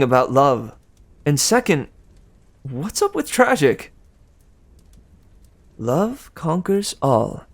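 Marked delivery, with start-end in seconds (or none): 5.8-7.4 slow, higher dreamy promise; promising Aphrodite. Acted. Aphrodite assuring Percy love wins, slow and higher. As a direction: promise it like a romantic goddess. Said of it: "Aphrodite promised".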